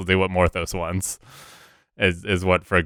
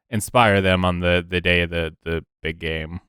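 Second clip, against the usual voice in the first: ordinary talk, nothing marked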